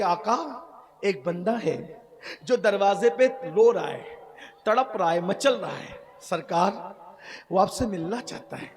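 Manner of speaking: gentle grieving narration. Acted. Soft retold story of weeping man.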